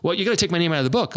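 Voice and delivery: quick, pushy mock demand; retold demand. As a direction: rattle it off like an irritated caller.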